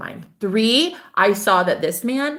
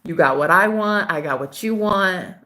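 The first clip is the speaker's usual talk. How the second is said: ordinary delivery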